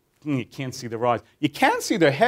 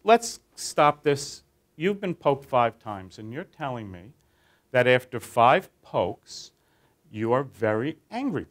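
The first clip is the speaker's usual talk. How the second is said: slow and deliberate, each phrase spaced out; 3 semitones lower, slower than usual. Retold or imitated. Therapist stopping the group to spell out their anger, slow. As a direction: lay it out slowly like a calm therapist. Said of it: "So I say".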